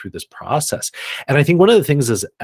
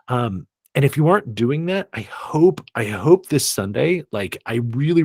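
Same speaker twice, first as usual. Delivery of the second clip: usual speaking voice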